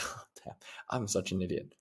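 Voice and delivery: soft, chuckled self-mockery; retold quiet laugh trailing into the words; laughing at himself. Man laughing at himself over the cat, quietly amused. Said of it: "So I started laughing".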